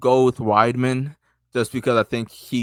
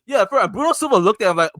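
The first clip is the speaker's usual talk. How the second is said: usual speaking voice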